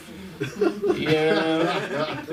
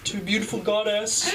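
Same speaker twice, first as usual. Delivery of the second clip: put-on dreamy lover boy; 6 semitones higher than usual. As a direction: gush like a lovesick romantic.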